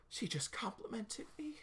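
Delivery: gasp, then high hushed whisper; awed whisper. Acted. Shy girl thrilled by a compliment, whispering. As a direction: whisper it in breathless delight.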